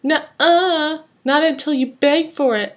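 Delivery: high, teasing taunt. A narrator's reading. Bully Joker holding back a child's lunch, high taunt. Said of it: "a boy known as Joker laughed".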